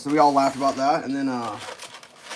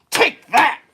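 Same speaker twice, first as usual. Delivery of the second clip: high, loud, punched-out taunt; mock vengeful shout; 9 semitones higher, 6 dB louder than usual. Girlfriend hands over the punishing sandwich, mock vengeful. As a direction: deliver the mock blow at full voice.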